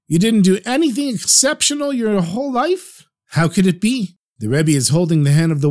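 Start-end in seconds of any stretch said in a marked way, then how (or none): 0.1-4.2 incredulous. Acted. Rebbe disbelieving old man.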